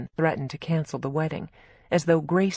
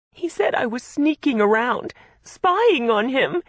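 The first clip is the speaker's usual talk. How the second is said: upset sister; 8 semitones higher, 5 dB louder than usual. A narrator's reading. Gracie upset, repeating accusation.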